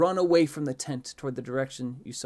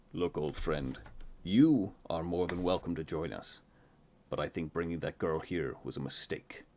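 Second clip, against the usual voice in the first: lower, measured character voice; grave old friend; 5 semitones lower than usual. A narrator's reading. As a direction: reason firmly in a lowered voice. Kriv reasons with uncle, overheard.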